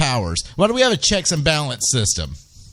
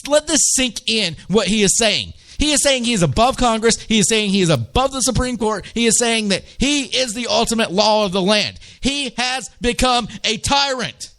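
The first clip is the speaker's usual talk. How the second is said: higher and higher, pressing every line; rising outrage; 7 semitones higher than usual. Real, not acted. Radio host to listeners, rising outraged emphasis. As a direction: build the outrage as he spells out the claim.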